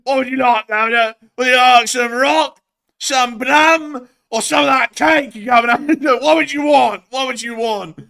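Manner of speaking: hammed-up high rodent hawking goods; shouty Marmot shopkeeper. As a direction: hawk rock and cake like a street seller. Marmot voice offering wares.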